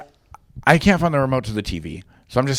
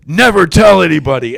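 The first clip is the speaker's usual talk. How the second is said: loud, raised-pitch order; emphatic demand; 4 semitones higher, 9 dB louder than usual. Retold or imitated. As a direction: act out a friend's urgent plea for secrecy. Retold friend swears him to secrecy.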